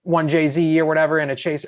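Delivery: ordinary talk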